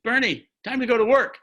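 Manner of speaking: wife calling her husband off to work, mock loud call; retold yell down the stairs. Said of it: "she yells down".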